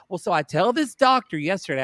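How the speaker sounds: ordinary delivery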